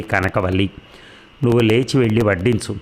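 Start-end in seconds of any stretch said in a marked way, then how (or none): none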